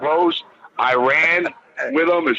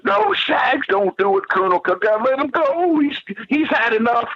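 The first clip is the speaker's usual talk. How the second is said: much higher, frantic begging; pleading Colonel; 10 semitones higher than usual. Retold or imitated. Colonel Park begging Shags to stop the beating, high frantic plea. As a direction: beg him to let go, pitched way up.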